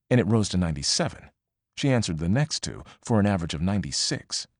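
plain reading voice

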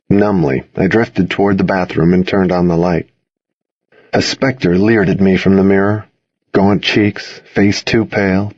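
plain reading voice

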